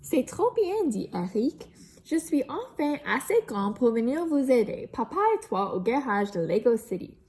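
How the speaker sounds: lively storybook read-aloud; reading to children. A narrator's reading. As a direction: tell it brightly to young listeners. Reads picture book to children.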